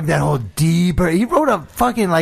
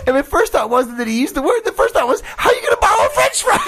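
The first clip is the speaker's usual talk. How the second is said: squealing through laughter; quoting while cracking up; laughing; 14 semitones higher than usual. Retold or imitated. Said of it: "retelling the teacher's line".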